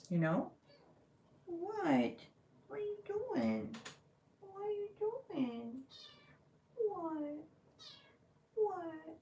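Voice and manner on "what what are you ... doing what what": pet talk. Real, not acted. Talking to her meowing cat in high baby talk. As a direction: coo at the cat in a much higher voice.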